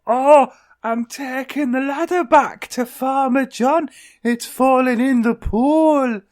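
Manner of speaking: high, dopey put-on duck voice; Dinky the Duck. Duck explaining the ladder to the cat, silly high voice. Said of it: "And Dinky went".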